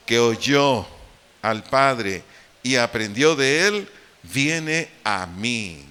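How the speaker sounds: scripture read aloud. Shown as a demonstration. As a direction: read each phrase out slowly for the congregation. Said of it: "leamos en voz alta".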